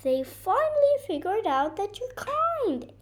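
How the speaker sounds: high, excited storybook voice; exclaiming. A narrator's reading. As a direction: exclaim the happy news. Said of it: "exclaimed Coco".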